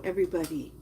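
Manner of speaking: normal talking voice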